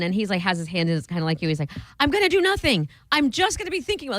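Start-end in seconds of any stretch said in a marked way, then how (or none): 2.0-4.2 imitating an office worker's hammed-up meltdown; mock freakout. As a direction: play a guy losing it at his desk. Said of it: "he's losing his mind".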